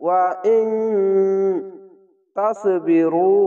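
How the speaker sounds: ওয়া ইন nasal, তাসবিরু with রু drawn; recited with ghunna. Shown as a demonstration. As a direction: hum the ন, stretch রু. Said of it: "এক আলিফ টেনে পড়বেন". (গুন্না ও টেনে পড়া)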